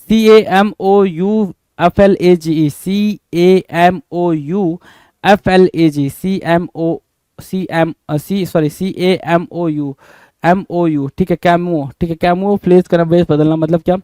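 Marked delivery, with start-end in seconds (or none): 0.1-11.1 slow spelling out. Shown as a demonstration. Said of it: "इसकी spelling".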